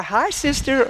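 high, sociable hello; mock-friendly hello in passing; put-on friendly greeting. Acted greeting while walking by.

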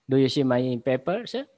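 normal talking voice

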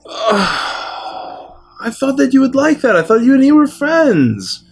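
high, plaintive hurt after a big sigh; wounded protest. Acted. Gift-giver to friend who rejected the dog carving, hurt.

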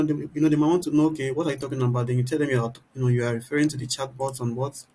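usual speaking voice

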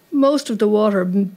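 ordinary delivery, nothing marked

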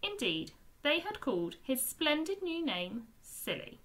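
plain reading voice

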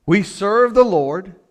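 high, loud preaching; forceful exhortation. Real, not acted.

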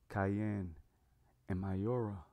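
plain reading voice

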